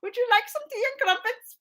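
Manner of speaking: high-pitched amateur British accent; lilting, put-on British voice. Shown as a demonstration. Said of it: "Do I sound British?".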